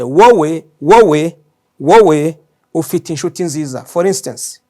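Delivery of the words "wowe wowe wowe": wowe hit loud each time; emphatic wowe. Shown as a demonstration. Teacher stresses wowe to the learner, loud and repeated. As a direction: punch wowe three times.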